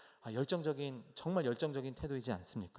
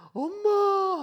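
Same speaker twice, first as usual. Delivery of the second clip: put-on a piercing, much higher and louder child's wail; crying for mom; 14 dB louder, much slower than usual. Injured little boy wailing for his mother, piercing cry. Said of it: "하고 울었던 것 같습니다".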